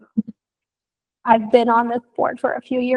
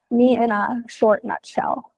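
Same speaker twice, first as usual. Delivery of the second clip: ordinary talk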